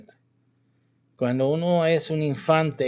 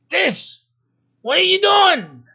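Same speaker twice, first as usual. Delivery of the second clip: much higher, louder mock angry outburst; acted anger; 7 dB louder than usual. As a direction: put on fake fury to scold the kid. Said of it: "But I act".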